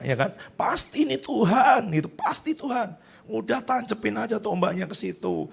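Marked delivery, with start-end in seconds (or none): 0.6-1.9 high, excited mock follower; pitched-up eager henchman; eager high urging. Followers urging David to kill. 2.1-5.5 eager urging. Retold or imitated. Followers urging David to kill.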